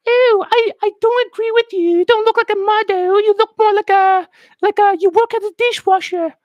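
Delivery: tiny British twit in high falsetto; little British person. Acted. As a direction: squeak it out as a mock little Brit. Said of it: "that's how it's like for the little shit".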